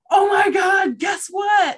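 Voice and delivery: loud, high-pitched excited shout; excited retold exclamation. Telling her friend about hearing her voice, excited shout. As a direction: shout it like bursting with news.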